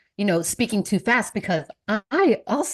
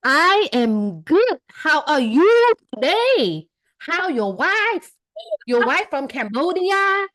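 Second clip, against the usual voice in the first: slow, higher, halting foreigner English; mock broken English; 7 semitones higher, slower than usual. Playing along with the American man, slow broken English. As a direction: play the clueless immigrant, slowly. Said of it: "So I played along".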